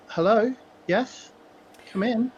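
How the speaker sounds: put-on high-pitched young voice from inside; young woman calling out. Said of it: "a young female voice call out".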